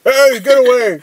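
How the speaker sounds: high, loud yappy little-dog bark; yappy chihuahua voice. Retold or imitated. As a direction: snap it out like a tiny angry dog. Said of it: "best Chihuahua impression".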